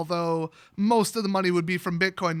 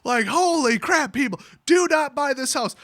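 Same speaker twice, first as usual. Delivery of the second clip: loud, high mock yell with a laugh in it; hammed-up realtor shouting a warning; 6 semitones higher, 6 dB louder than usual. Realtor yelling at buyers to stay away from her own listing, mock shout.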